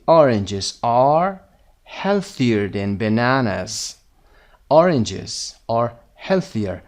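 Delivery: very slow, carefully spaced words; slow model reading. Shown as a demonstration. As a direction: read it out slowly for learners. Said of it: "teacher reads answer slowly".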